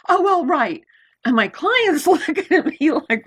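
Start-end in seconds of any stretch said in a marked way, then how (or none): 0.1-0.8 annoyed. Retold or imitated. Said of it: "I just threw it down".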